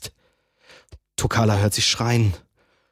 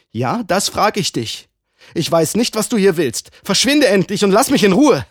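erst langsam, dann laut und höher; wütend abweisend; 9 semitones higher, 6 dB louder than usual. Acted. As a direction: jag ihn fort. Frau schickt ihn weg. (laut und energisch wegschickend)

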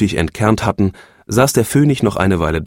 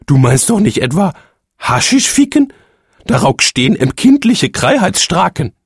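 hohe, schrille Empörung; empörte Phönigin; 11 semitones higher, 4 dB louder than usual. A narrator's reading. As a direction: moralisch entrüstet zurechtweisen. (die Phönigin weist ihren Mann entrüstet zurecht)

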